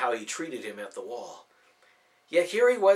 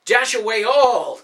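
big, slow, soaring command to the reindeer; confident shout; 9 semitones higher, 12 dB louder, slower than usual. A narrator's reading. Nicholas commands the reindeer to fly, confident shout. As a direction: call it out with full belief. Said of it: "with a confidence he lacked".

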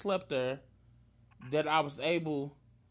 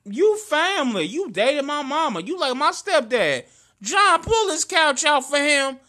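raised, higher voice, quoting her forcefully; retold insistent outburst; 7 semitones higher, 6 dB louder than usual. Retelling her plea to let him stay, raised and insistent. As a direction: press each claim loudly, as she did.

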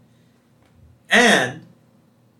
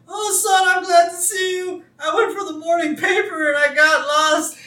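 very high, loud old-man impression; Grandpa; 6 dB louder than usual. Grandpa calls to his son in the woods, high loud voice. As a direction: put on a loud, high-pitched old codger. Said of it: "So Grandpa pops up like".

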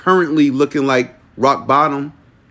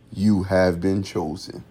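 low, slow, softer pronouncement; solemn caller; 7 semitones lower, 6 dB quieter, slower than usual. Acted. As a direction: announce it gravely down the phone. Acting the caller on the phone.

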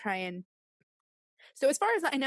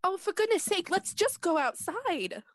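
higher-pitched, fed-up outburst; out of patience, pitch raised; exasperated; 9 semitones higher than usual. Acted. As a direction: urge them on impatiently, drawing out the words. Player's character impatient to leave.

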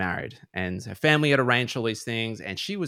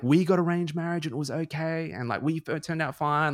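parents' voice; 8 semitones higher than usual. Retold or imitated. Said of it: "her parents were like".

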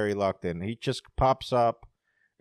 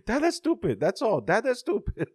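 put-on high-pitched daddy talking to a child; reassuring father; 11 semitones higher than usual. Dad explaining his anger to his daughter, reassuring. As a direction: imitate a dad explaining in baby talk. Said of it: "He said".

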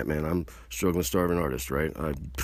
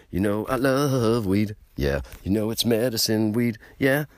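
louder, higher, slow, drawn-out announcer read; hammed-up weed ad; 7 semitones higher, 7 dB louder, slower than usual. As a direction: sell weed like a cheesy public service ad. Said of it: "Gotta do P S A for weed".

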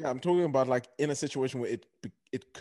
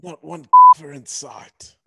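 loud quote; 4 semitones higher, 22 dB louder, slower than usual. Retold or imitated. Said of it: "who was that famous guy who said".

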